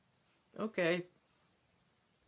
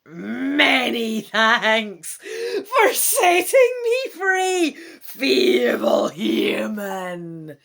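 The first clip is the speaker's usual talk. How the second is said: loud, booming, high dragon voice; roaring dragon; 4 semitones higher, 13 dB louder, much slower than usual. Acted. Freed red dragon mocking the feeble human, booming roar. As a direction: bellow it down like a freed beast. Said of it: "The red dragon roars down at you".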